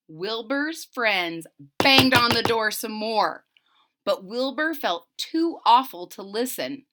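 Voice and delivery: ordinary delivery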